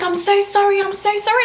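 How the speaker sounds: high, mock-panicked apologising; high, flustered re-enactment; flustered apology. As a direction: imitate a shocked intruder apologising. Acting the intruder's flustered apology.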